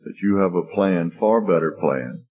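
low, measured congregational prayer. Real, not acted. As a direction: earnestly address God with long pauses. Leads closing prayer.

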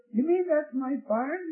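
high, disbelieving question; incredulous question. Retold or imitated. Farmer shown his farm from the airplane, incredulous.

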